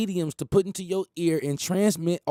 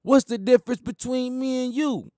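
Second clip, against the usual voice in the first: higher, stressing What's; quoting [name]; 8 semitones higher than usual. Retold or imitated. Quoting [name]'s line to the audience, higher. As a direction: lift it like a quoted lyric. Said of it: "Damn, [name] said it".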